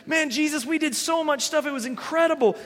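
bubbling over after the mission; excited apostles. Retold or imitated. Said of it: "they were all excited".

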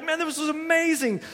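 bubbling over after the mission; excited apostles. Retold or imitated. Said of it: "they were all excited".

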